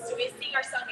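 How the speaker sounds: ordinary talk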